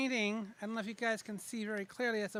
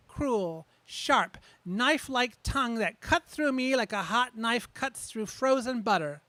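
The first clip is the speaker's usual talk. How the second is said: loud, furious recital; angry poem reading; 8 dB louder than usual. Acted. Poet reading Daddy Revisited to the crowd, loud and furious. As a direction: hurl every line at a dead father.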